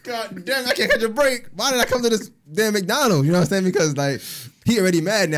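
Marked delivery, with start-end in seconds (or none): 0.0-3.3 high, loud exasperated complaint; exasperated outburst. Retold or imitated. Groaning to friends about McDonald's, high exasperated outburst. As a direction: vent about the bad luck loudly. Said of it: "I said".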